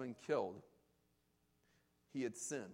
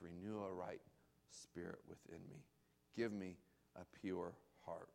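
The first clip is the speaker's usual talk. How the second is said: humble prayer; 5 semitones lower, 8 dB quieter than usual. Retold or imitated. David turns to God in prayer.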